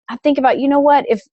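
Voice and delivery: normal talking voice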